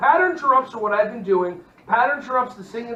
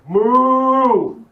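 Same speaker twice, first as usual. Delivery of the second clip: loud, drawn-out cow moo; animal noise; 2 semitones higher, 9 dB louder than usual. Shown as a demonstration. Presenter shows how to get kids' attention, loud cow moo. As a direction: bellow a long moo like a cow. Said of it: "when I say do animal noises".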